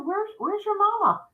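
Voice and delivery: very high voice for a little one; concerned, to a child. Retold or imitated. Woman asking a lost little girl about her mother, concerned. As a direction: coo the question to a lost child.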